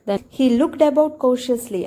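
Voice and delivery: slow, careful reading of the passage; slow reading aloud. Shown as a demonstration. Teacher reading the story slowly to students. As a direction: read it out slowly for the class.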